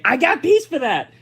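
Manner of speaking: high-pitched mocking claim of credit; mock boast. Host imitates the president claiming peace, mock boastful. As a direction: parody an eager brag.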